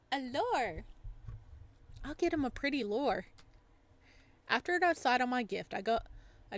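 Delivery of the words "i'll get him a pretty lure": usual speaking voice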